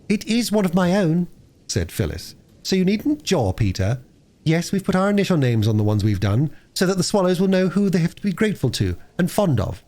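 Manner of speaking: put-on bright eager little girl; a lightly voiced excited young girl. Phyllis voiced.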